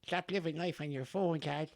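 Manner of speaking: high-pitched put-on Offaly farmer, gentler, at normal loudness; baby son's Offaly farmer voice. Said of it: "that's his voice".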